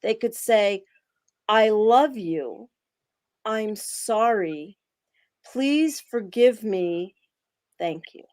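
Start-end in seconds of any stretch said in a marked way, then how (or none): 1.5-8.2 each phrase slowly, with long pauses; slow heartfelt sequence. Shown as a demonstration. Demonstrating the love-first order for listeners, slow and deliberate. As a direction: let every phrase land slowly, one at a time. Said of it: "Something like this".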